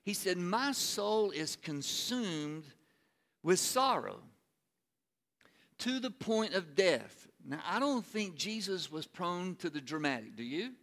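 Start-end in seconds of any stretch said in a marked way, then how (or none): 0.4-7.3 slow, weighty anguished quote; pointed. Acted. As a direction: draw out each word of sorrow. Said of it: "he says something so pointed".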